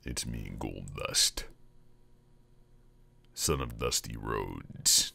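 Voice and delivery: very deep, slow, drawn-out wrestler voice; Goldust. Acted. Wrestler introduces himself at the door, very deep voice. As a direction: announce yourself low and soft, lingering on each word. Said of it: "Goldust actually had a very deep voice".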